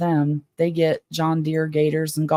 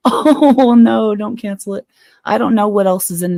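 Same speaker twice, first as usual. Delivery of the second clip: laughing; 5 semitones higher, 7 dB louder than usual. Real, not acted. Viewer jokes about cancelling book.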